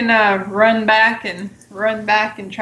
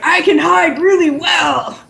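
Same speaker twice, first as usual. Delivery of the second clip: dopey Minotaur voice; 7 semitones higher than usual. Retold or imitated. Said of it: "Minotaur who were like".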